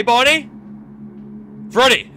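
normal talking voice

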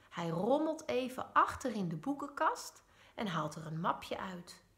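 usual speaking voice

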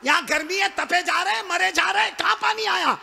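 high, loud exasperated retort; scoffing shout. Acted. The chiefs shout back scornfully at the caller. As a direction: fling it back at him, fed up.

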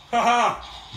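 high, loud mock-heroic "ha ha"; triumphant laugh. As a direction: crow at your foe. Triumphant fighter in play.

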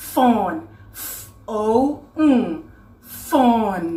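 "fawn" said whole, sounded out piece by piece, then whole; "fawn" modelled slowly for learners; sounding out. Shown as a demonstration. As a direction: break "fawn" into separate sounds with pauses, then blend it. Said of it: "อ่านออกเสียงว่า".

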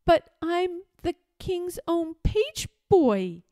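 put-on high-pitched, distressed boy; gasped protest. A narrator's reading. Page boy to Lord Droon on being banished, dismayed. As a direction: plead in shock. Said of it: "gasped Eric".

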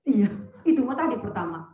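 ordinary talk

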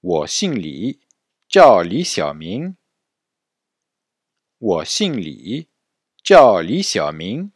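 slow Mandarin phrases to repeat; model for repetition. Shown as a demonstration. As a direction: say "Wo Xing Li, Jiao Li Xiaoming" slowly for the class. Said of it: "Répétez après moi". (modèle lent à répéter)

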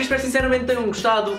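ordinary talk, nothing marked

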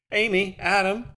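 high, loud calling out; shouted. Acted. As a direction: push through calling their names. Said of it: "the woman shouted".

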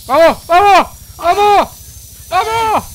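high frantic yelling; excited shouting. Real, not acted. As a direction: shout it out, all fired up. Frantic moment in firefight.

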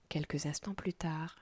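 ordinary delivery

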